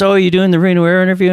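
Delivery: lightly voiced old pilot, perking up; excited old guy. Old man on the porch asks the wet applicant, eager and fast. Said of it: "he goes".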